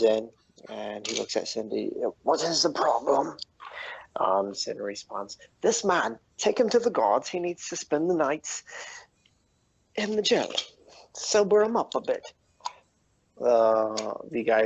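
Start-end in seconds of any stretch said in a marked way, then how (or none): 2.2-3.4 lightly voiced, slightly raised bouncer voice; half-orc bouncer. Half-orc bouncer looks at Sin and asks, lightly gruff role. 5.6-12.3 high-pitched, emphatic orders in short bursts; commanding tavern keeper. Acted. Sin orders the bouncer to take the drunk away, commanding.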